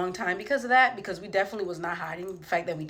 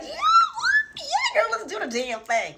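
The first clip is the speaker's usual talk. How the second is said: much higher, shouted, hammed-up cheer; fake enthusiasm; much slower than usual. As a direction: cheer her on while secretly plotting. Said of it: "I didn't even say that. I just was like".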